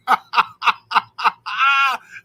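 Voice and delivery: big high cackling laugh; loud laugh. Real, not acted. Cracking up at his own lunchroom story, loud laugh. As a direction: burst out laughing.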